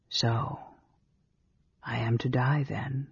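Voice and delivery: soft, weary resignation; weary and resigned. A narrator's reading. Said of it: "just weary and resigned".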